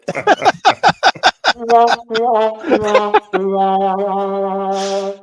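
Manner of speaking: long, loud, high laughing fit; loud belly laugh that keeps going; helpless real laughter. Real, not acted. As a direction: crack up completely at your own story. Cracking up at own phone story.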